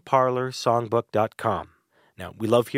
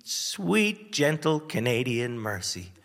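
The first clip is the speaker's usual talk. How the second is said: usual speaking voice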